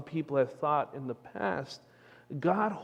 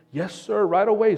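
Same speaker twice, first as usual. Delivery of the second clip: slightly higher, eager-to-please banker; mildly eager; 6 semitones higher, 6 dB louder than usual. Acted. Bank manager to cowboy with money, mock eagerness. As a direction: jump to serve a rich customer.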